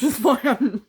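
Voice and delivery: high, loud words breaking into laughter; laughing while talking. Real, not acted. Admitting the joke was about slurs, laughing through it. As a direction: burst out laughing mid-sentence. Laughing over her own remark.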